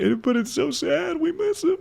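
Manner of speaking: very high, soft falsetto of fake grief; mock sad voice. Streamer mocking grief for the step-grandpa, high fake-sad falsetto. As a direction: coo a hammed-up lament.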